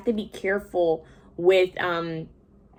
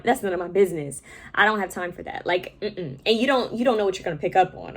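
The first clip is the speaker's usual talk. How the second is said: ordinary delivery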